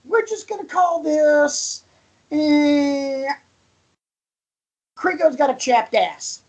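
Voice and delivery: high-pitched silly segment intro; mock announcement. Podcast host renaming co-host's rant segment, high mock announcement. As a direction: announce it high, stalling before the punchline.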